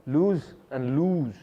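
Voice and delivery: slow "lose and lose", each vowel drawn out; emphasised vowels. Shown as a demonstration. Teacher showing learners two near-identical words, "lose and lose" with emphasised vowels. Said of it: "give emphasis to every, uh, vowel".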